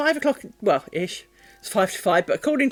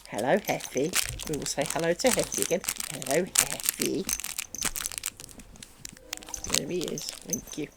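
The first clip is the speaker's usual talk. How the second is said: soft greeting; 4 semitones lower, 8 dB quieter than usual. Real, not acted. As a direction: murmur hello to the pet. Greeting the dog softly.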